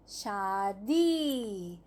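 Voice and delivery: शादी drawn out very slowly, each syllable clear; slow spelled-out word. Shown as a demonstration. Teacher to young pupils, slowly drawing out शादी. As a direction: stretch शादी out slowly for the class. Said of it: "बड़ी की मात्रा दी".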